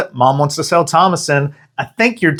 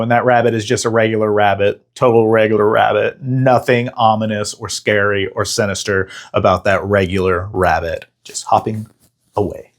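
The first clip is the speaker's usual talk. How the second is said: ordinary talk, nothing marked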